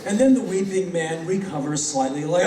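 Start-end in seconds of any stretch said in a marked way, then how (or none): none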